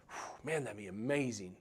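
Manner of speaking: hushed, dreamy mock amazement; awed daydream. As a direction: let out a quiet whew at the dream. Imagining the payoff, awed.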